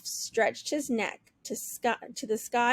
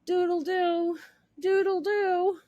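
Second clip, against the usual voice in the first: half-hearted doodle-doo; sleepy crow; 6 semitones higher than usual. Acted. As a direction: crow groggily. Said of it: "Toodles grumbled and rubbed her eyes".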